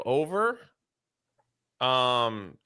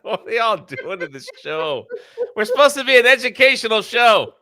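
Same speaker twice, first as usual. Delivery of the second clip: laughing through the words, louder; cracking up while scolding; 7 semitones higher, 8 dB louder than usual. Real, not acted. Laughing at the ad read.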